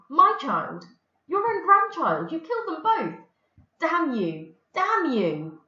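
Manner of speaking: high, loud, furious accusation; at breaking point. Acted. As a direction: hurl it at her, voice near breaking. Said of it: "nearly at breaking point".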